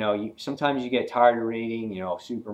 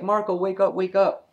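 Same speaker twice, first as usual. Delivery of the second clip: urgent wake-up call; 8 semitones higher, 3 dB louder than usual. Retold or imitated. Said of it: "she's like".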